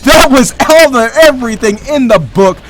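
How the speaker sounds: hyped shoutcaster in full flow; excited play-by-play. Real, not acted. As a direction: call the action as it explodes. Live esports play-by-play.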